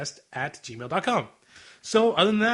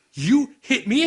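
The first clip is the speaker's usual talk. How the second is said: higher, louder indignant retort; shocked indignation; 8 semitones higher, 5 dB louder, much slower than usual. Retold or imitated. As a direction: act out an outraged, disbelieving question. Acted quote, slapped woman protests.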